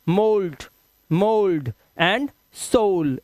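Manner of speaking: mold and soul said slowly with a pure o; drawn out mold, soul with plain o; model pronunciation. Shown as a demonstration. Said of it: "इसे साउल वाउल कुछ नहीं बोलते". (mold, soul धीरे, साफ ओ के साथ)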